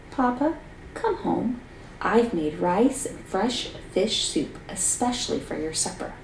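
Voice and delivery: soft, steady call to father; walk slowly toward the dragon, speaking softly; calm and quiet. Acted. Seven calmly approaches the dragon.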